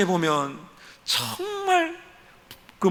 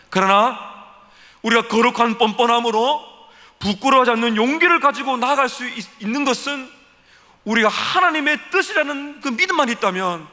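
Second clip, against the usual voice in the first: fervent preaching; 6 semitones higher, 4 dB louder than usual. Real, not acted. Preaching at full force.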